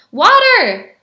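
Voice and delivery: high, loud drawn call; cried command. A narrator's reading. Beggar ordering water for the pot, high loud cry. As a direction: call out the next ingredient. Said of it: "he cried".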